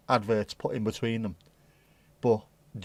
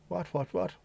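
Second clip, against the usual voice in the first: surprised; 7 semitones higher, 4 dB quieter than usual. Real, not acted. Reacting to chat.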